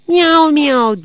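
high, loud meowed words, falling away; cat meow. A narrator's reading. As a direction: voice a drawn-out cat's miaow. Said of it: "diye mırıldandı".

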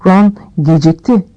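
plain reading voice

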